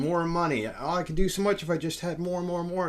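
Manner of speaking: high-pitched greedy pleading; mock craving. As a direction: imitate someone who can never get enough. Mimics people always craving more.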